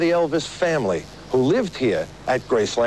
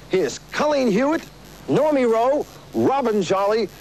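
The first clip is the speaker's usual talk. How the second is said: rising, higher host announcement; big showman intro; 7 semitones higher than usual. Real, not acted. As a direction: build the crowd up for the act. Host introducing the opening act.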